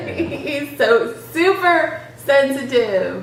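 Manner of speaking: SUPER stretched out high, laughing; teasing emphasis. Real, not acted. Teasing partner while laughing.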